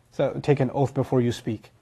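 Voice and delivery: ordinary talk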